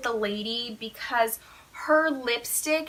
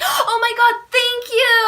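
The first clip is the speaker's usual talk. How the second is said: high, loud squeal of joy; screaming; 11 semitones higher, 8 dB louder than usual. Retold or imitated. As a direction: scream your thanks at full tilt. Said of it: "I literally screamed, I was like".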